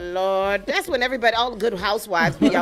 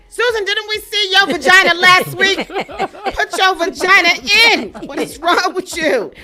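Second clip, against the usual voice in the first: exasperated police officer, louder, higher; fed-up cop; 10 semitones higher, 8 dB louder than usual. Retold or imitated. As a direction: scold her like a tired cop. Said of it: "Because he's like".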